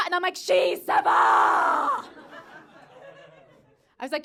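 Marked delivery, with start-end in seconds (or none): 0.4-2.1 long, drawn-out mock yell; exasperated mother shouting in French; insistent shout. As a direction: bellow it back, higher and stretched out. Mother insists the child is fine.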